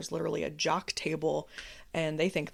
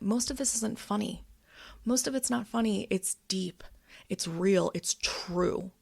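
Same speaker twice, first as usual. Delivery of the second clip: ordinary delivery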